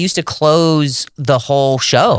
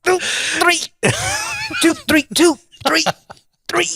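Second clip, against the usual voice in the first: high falsetto count. Pretend.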